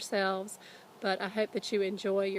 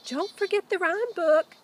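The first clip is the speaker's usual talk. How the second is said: put-on girl's reminder; 11 semitones higher, 7 dB louder than usual. Annie reminds Jack.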